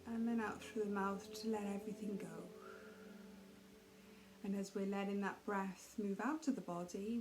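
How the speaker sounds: calm, even meditation voice with long pauses; guided meditation. Real, not acted. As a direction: ease them gently through each breath, unhurried. Leads a guided breathing meditation.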